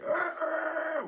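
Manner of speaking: very high, sharp squeal of braking tires; tire screech imitation. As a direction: make the screech of tires slamming to a stop. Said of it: "screeches his tires, like".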